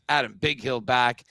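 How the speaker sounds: ordinary talk, nothing marked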